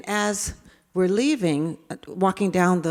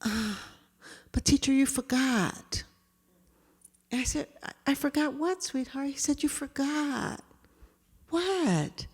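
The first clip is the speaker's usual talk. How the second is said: soft, higher, gentle voices; hushed tender exchange; 6 semitones higher, 7 dB quieter than usual. Retold or imitated. Teacher and David after the prayer, hushed and soft. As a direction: say it softly like a moment with a child.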